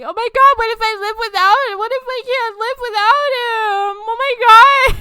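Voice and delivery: high, loud mock wail; mock sobbing. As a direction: ham up the teen heartbreak. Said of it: "And then I was like".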